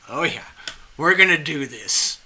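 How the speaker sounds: rally yourself, pumped for a fight; ready to fight. Retold or imitated. Spirit of a Southern lady ready to fight, pumped up. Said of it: "it's that kind of tone".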